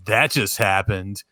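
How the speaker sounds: low, deadpan movie cool guy; too-cool action hero. Acted. Mocking blockbuster heroes' quips, mock cool guy. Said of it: "a real cool guy's".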